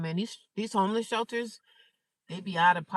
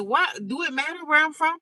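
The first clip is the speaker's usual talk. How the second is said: incredulous; 8 semitones higher, 4 dB louder than usual. Retold or imitated. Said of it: "I'm looking at him like".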